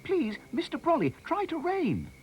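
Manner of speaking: high, urgent call for help; loud-ish, high-pitched begging; pleading shout. A narrator's reading. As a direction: cry out high like a desperate boy. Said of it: "shouted Harry".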